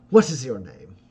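smooth, courtly schemer, slow and deliberate; scheming noble father. Acted. Ferdinand greets his son's companion in a courtly, flattering voice. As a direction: lay on the flattery like a silver-tongued lord.

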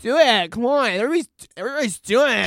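loud, high mocking peer-pressure chant. Friends pushing him to join baseball, loud high taunting. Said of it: "that's what peer pressure sounds like".

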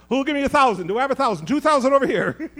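loud, high, rapid bid calling; auctioneer. Pretend. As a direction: work the crowd for bids. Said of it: "I'm getting weird".

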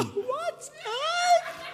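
very high, stretched What's up; high comic voice; mock squeaky greeting. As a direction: squeal it out as a joke. Jesus greeting, mocked high.

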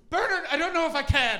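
high, loud, hammed-up posh lady; posh nervous woman. Woman at the station pleading with Bernard, high hammed-up posh voice. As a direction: squeal it like a frightened posh woman.

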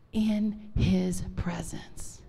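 slow, reverent near-whisper; breath-soft and drawn out; hushed. Real, not acted. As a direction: drop to a slow, soft hush. Solemn close of the testimony.

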